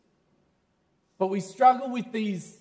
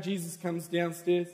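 ordinary talk, nothing marked

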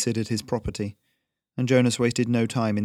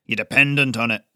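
put-on gruff old smith; 3 dB louder than usual. A narrator's reading. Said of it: "Jonas growled".

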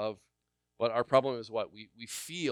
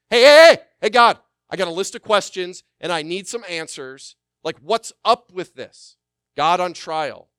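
mock complaining shout, much higher and louder; demanding believer; 7 semitones higher, 18 dB louder than usual. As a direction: hammer God with a list of demands. Mocking a believer demanding answers.

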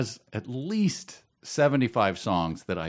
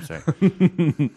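laughing; 7 semitones higher, 7 dB louder than usual. Real, not acted. Laughing at his own joke.

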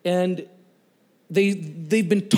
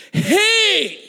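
sudden high, loud holler; yell; 7 dB louder than usual. Retold or imitated. Preacher shouts at the gang taunting Wayne, yelling. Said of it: "and I yelled".

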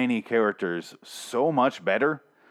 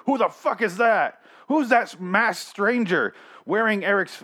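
much higher, slow, over-the-top puzzled voice; mock confusion; 9 semitones higher, 3 dB louder, slower than usual. Reviewer mocking a confused viewer, high and hammed-up. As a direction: play dumb as hammed-up bewilderment. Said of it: "I wouldn't be like".

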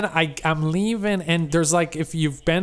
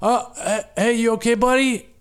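concerned passerby; 6 semitones higher than usual. Retold or imitated. Driver passing a stranded biker, high mock concern. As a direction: play a too-friendly passerby checking on him. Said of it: "every car that goes by is like".

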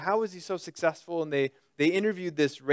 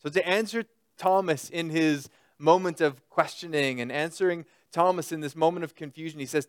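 ordinary talk

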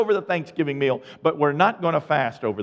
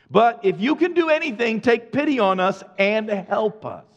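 higher-pitched, mounting plea; pleading father; 6 semitones higher than usual. Acted. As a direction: beg for help for your child. Father begs Jesus for help.

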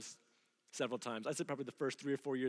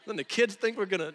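louder, defensive explaining; wife's heated comeback; 5 semitones higher, 11 dB louder, faster than usual. Retold or imitated. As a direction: push back at him with rising frustration. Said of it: "And she'll go".

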